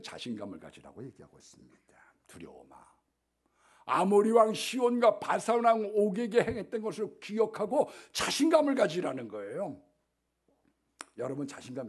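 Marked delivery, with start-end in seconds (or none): 0.0-2.8 숨소리로 조용히 속삭이는 설교; 귀에 대고 말하듯 속삭여; 속삭임. Real, not acted. Hushed sermon point. 3.8-9.8 크고 높게 외치는 권면; 회중을 북돋우듯 힘껏 외쳐. Acted. Sermon loud exhortation.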